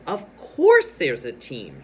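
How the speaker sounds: loud, emphatic, slowed exclamation. Shown as a demonstration. Missus Teaberry reassures Mister Putter, exclaiming loudly. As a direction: belt out "course" and draw it out. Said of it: "sentence that has an exclamation point".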